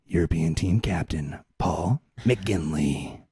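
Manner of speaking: low, slow, solemn roll call; mock announcer. Host reading out the European team in mock announcer voice. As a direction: intone each name deep and deliberate.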